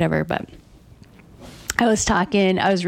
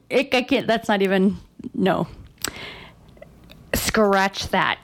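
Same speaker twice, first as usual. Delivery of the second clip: ordinary delivery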